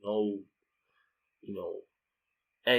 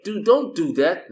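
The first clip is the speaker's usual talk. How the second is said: raised, slow scolding; 7 semitones higher, 6 dB louder than usual. Retold or imitated. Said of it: "I'm like".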